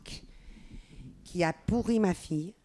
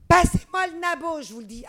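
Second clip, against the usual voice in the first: insistent demand; 10 semitones higher, 7 dB louder than usual. Retold or imitated. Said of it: "Ils ont dit".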